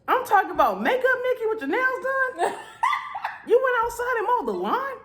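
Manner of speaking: high, laughing, stunned squeal; can't believe she did that; laughing disbelief. Real, not acted. Telling viewers about wife mowing, high laughing disbelief.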